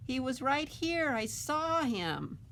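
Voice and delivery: high, loud, slow insisting; insistent protest. Acted. Ben insisting to the doubtful crowd that Bigfoot is real. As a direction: convince them it's true. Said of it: "But Ben says".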